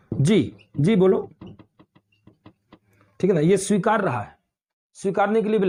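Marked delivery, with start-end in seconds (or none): 0.1-1.2 polite acceptance. Shown as a demonstration. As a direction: answer respectfully: "जी, जी बोलो". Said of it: "teacher demonstrating acceptance replies".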